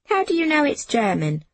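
a touch of eager curiosity; curious girl. A narrator's reading. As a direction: ask it high, falling at the end. Girl eagerly questioning old Fred.

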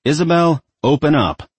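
loud, high call through door. A narrator's reading. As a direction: shout it at the door. Calling through a closed door.

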